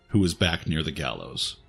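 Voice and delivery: ordinary delivery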